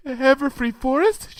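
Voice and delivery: high, small frightened question; timid. A narrator's reading. Fluttershy under the blanket, timidly. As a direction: shrink back from the idea. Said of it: "she said timidly".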